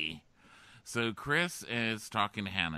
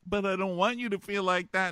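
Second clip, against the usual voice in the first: lightly mocked pleading guy; 10 semitones higher, 5 dB louder than usual. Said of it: "And he's like".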